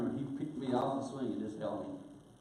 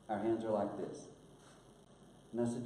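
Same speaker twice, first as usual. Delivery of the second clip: ordinary talk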